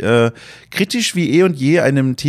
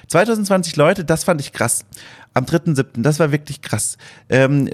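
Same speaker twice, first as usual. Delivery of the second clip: ordinary delivery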